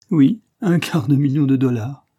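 mildly acted amusement; spluttering with mirth. A narrator's reading. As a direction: blurt it out as if stifling laughter. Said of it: "dit Parr en pouffant".

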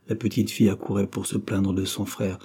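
ordinary delivery, nothing marked

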